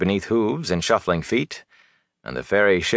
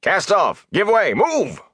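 shouted orders; 12 semitones higher, slower than usual. Acted. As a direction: bellow across the deck. Ferryman barking orders to haulers.

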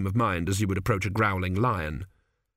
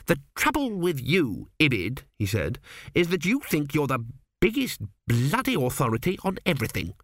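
higher, huffy little man; peevish retort; 4 semitones higher than usual. A narrator's reading. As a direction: snap back indignantly. Zeno retorts in story.

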